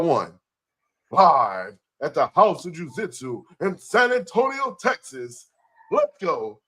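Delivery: big showman's announcement, rising high; ringmaster. Acted. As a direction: roll it out like a circus ringmaster.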